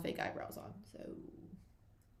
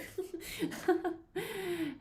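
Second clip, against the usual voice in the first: high giggle; 12 semitones higher than usual. Real, not acted. Laughing after pretend reunion.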